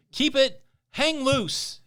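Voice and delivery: loud, high, hearty call; hearty sign-off. Pretend. Host signs off a dedication to California, shouting heartily. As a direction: shout it out like a radio catchphrase.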